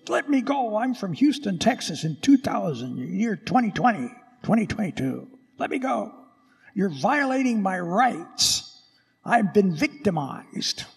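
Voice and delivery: high, pleading mock protester. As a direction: whine at the soldiers. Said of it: "you would be fighting the Roman soldiers".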